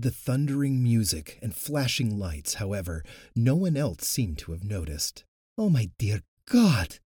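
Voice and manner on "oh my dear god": loud, high horrified gasp. Acted. Natasha seeing riders swallowed, gasping in horror. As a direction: blurt it out in shock. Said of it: "Natasha gasped".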